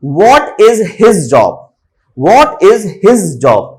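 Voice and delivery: slow, separate "What is his job"; word-by-word, uncontracted "What is his job"; every word emphasized. Shown as a demonstration. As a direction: give "his" its full weight. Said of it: "his को मुझे ज्यादा focus नहीं करना".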